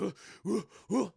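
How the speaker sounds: eerie creature cooing; mock creature ooh sounds; monster noises. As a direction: coo like a prowling monster. Monster queen chasing someone.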